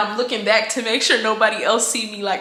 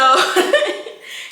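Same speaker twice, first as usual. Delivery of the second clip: high giggle; 9 semitones higher than usual. Real, not acted. Laughs while telling story.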